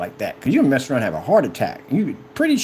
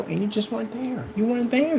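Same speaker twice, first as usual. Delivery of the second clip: ordinary delivery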